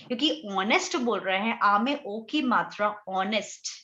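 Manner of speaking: ordinary talk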